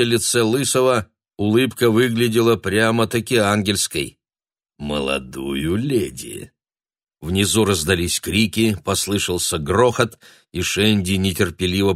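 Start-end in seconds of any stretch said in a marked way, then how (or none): 4.8-6.5 низкий медленный голос; спокойный голос великана; giant's low voice. A narrator's reading. Лысый великан отвечает. (низкий голос великана)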